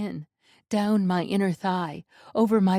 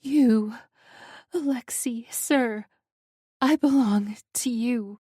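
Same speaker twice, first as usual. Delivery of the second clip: tearful surrender; 4 semitones higher than usual. A narrator's reading.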